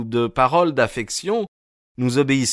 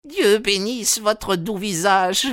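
very high, slow, overwrought woman's voice; hysterical old woman; 12 semitones higher, slower than usual. A narrator's reading. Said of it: "s'écria-t-elle d'une voix hystérique".

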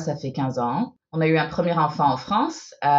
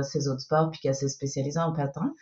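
ordinary talk, nothing marked

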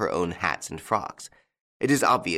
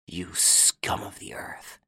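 slow breathy whisper of contempt; whispered insult; 4 dB quieter, slower than usual. A narrator's reading. Inspector whispers insult at Lupin, slow whisper. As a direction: hiss it out in a whisper, slowly. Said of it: "he muttered".

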